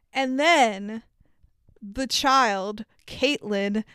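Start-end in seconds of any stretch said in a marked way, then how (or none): none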